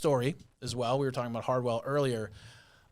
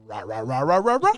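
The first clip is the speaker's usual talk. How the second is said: Scooby-Doo babble; 7 dB louder than usual. Retold or imitated. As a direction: mimic mocking Scooby-Doo gibberish, rising sharply. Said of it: "He Scooby-Dooed me".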